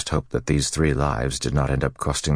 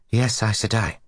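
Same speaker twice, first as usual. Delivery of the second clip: anxious; 8 semitones higher than usual. Acted. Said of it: "the novice said with an anxious curtsy".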